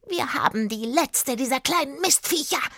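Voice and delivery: high, croaky witch voice; raspy, wicked old witch; smoky croaking witch. A narrator's reading. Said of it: "mit ihrer rauchig krächzenden Stimme".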